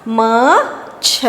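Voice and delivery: slow, low, drawn-out syllables; sounding out words. Shown as a demonstration. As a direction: stretch each syllable slowly. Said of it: "तो क्या शब्द बन गया?".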